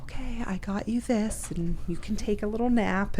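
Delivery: soft, coaxing care; a hint of tender nurse voice; imitating a soft, caring partner; gentle caretaking. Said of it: "And now she's like".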